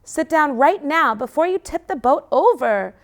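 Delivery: loud, high sharp order. Acted. Sister ordering the puppy to sit, high and loud. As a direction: order him down firmly. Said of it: "she orders".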